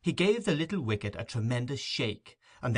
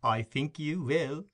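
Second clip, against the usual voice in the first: higher, slow sly coax; knowing insistence; 6 semitones higher, slower than usual. A narrator's reading. Mrs Mann seeing through the beadle's refusal, slow and sly. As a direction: tease him knowingly.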